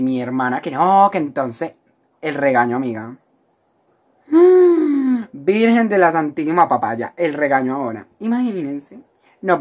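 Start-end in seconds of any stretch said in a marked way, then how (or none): 4.3-5.3 long, high-pitched gasp of dread; dramatic gasp. Acted. Storyteller remembering her sister's scolding, dramatic gasp. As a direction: suck in air in theatrical horror.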